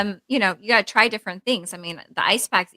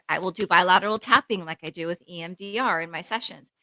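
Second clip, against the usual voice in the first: usual speaking voice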